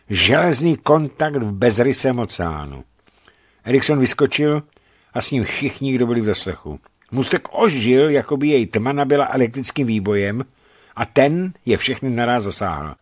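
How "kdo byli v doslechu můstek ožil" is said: ordinary delivery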